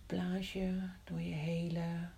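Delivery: ordinary talk, nothing marked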